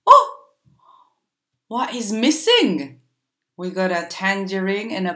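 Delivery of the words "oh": much higher and louder sudden cry; big surprised oh. Acted. Teacher pretending to be amazed for the children, surprised. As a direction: fake astonishment at the trick.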